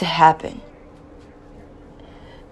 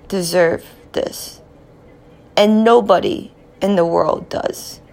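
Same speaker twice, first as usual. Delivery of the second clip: normal talking voice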